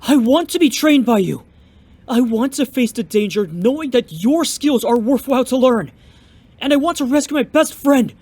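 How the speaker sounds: high, eager begging to be taken on; desperate pleading. Acted. Young fighter begging the master to train him, high eager pleading. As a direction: plead with everything you have.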